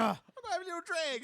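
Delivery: put-on high, lilting Irish accent; sing-song Irish brogue, playful. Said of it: "You sound Irish there".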